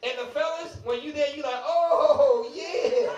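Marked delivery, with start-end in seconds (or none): 1.6-3.1 mock club fella. Preacher playing a fella at the club, mock high "oh yeah". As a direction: ham up a guy vibing at the club.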